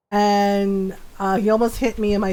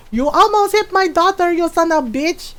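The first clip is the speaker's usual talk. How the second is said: mock angry yell; 7 semitones higher than usual. Mom yelling at the driver who nearly hit her daughter, high angry shout. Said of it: "and then yelling".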